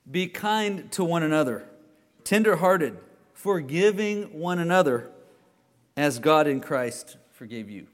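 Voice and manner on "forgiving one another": usual speaking voice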